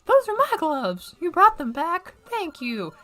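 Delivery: lightly voiced game dialogue; cheerful game character.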